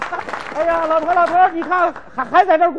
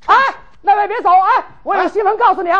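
loud, higher call to stop someone leaving; 4 semitones higher, 5 dB louder than usual. Acted. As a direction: hail a passer-by, eager to share news. Calling after a passer-by with news.

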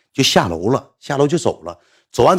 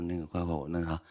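much softer, hushed apology; very quiet; 8 semitones lower, 18 dB quieter than usual. Retold or imitated. Apologising to the woman in the dark, very quiet. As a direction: say it under your breath so nobody wakes. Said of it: "我还老小声了".

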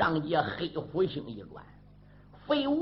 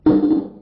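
loud grunt; 9 semitones higher, 11 dB louder than usual. Acted. Shouted grunt after cry.

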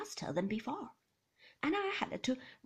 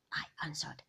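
ordinary delivery

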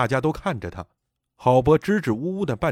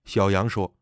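usual speaking voice

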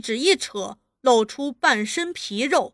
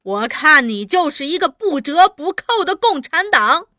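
loud, higher denunciation; angry accusation; 4 semitones higher, 6 dB louder than usual. A narrator's reading. Interrogator accusing slapped woman, angry. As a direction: hammer home the accusation.

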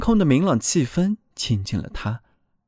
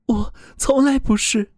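higher, earnest voice of a frightened soul; pleading soul voice; 10 semitones higher than usual. A narrator's reading. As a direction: speak up as a soul defending itself. Soul denies.

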